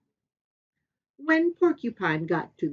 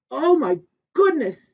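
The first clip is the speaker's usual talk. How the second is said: dismayed; 7 semitones higher, 9 dB louder than usual. Acted. Sky King dismayed.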